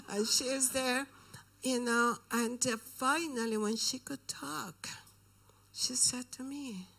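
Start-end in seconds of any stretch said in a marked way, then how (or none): none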